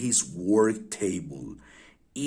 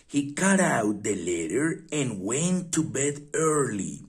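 ordinary delivery, nothing marked

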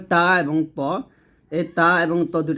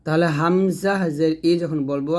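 ordinary delivery

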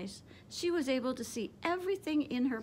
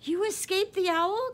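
much higher, louder joyful cry; cried out; 8 semitones higher, 7 dB louder than usual. A narrator's reading. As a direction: burst out in amazed delight. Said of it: "cried Stella Luna".

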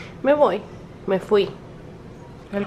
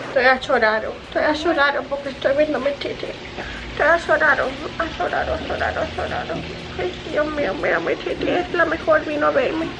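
put-on high, babyish lisped voice; cutesy pretend baby, pitched up; voiced baby; 8 semitones higher than usual. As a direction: gush as an amazed little baby. Voicing the amazed baby.

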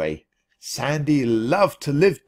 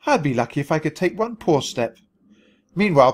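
ordinary delivery, nothing marked

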